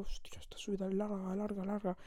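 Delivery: breathy whisper, half to herself; whispered thought. Retold or imitated. Alone on the rising coaster, whispering to herself. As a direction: whisper it like a private thought mid-ride. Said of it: "No sé si lo dije en voz alta".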